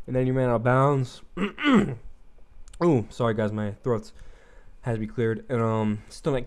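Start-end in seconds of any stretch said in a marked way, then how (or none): 1.3-2.8 throat clearing. Real, not acted.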